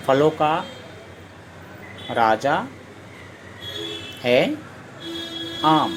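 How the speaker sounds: each word set apart by long pauses; slow dictation. Shown as a demonstration. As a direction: dictate slowly, one word at a time. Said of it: "तो फल". (धीरे-धीरे बोलकर लिखवाना)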